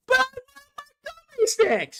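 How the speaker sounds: put-on high whining kid voice; whining child. Child whines at mother for gummy snacks, high whining kid voice. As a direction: whine it like a toddler who wants his snacks. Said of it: "Stop whining".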